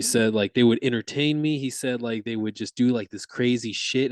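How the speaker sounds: normal talking voice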